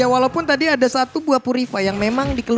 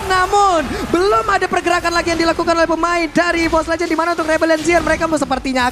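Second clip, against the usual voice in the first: excited play-by-play; 9 semitones higher than usual. Real, not acted. Hyped play-by-play call.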